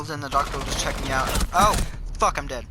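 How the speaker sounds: ordinary talk, nothing marked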